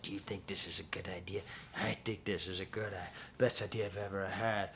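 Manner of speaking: low, hushed imitation of the track; weird whispered voice. Reviewer imitating the song's weird spoken bit, whispered. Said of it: "it's got this weird".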